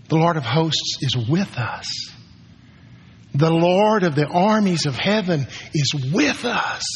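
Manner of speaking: ordinary talk, nothing marked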